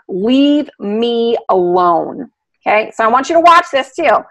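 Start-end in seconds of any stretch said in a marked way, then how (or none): 0.1-2.3 slow, drawn-out plea to be left alone; voicing the frightened dog. Speaking for the scared dog, slow and pleading. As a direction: stretch it out like a cowering dog. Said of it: "He's, he's basically going".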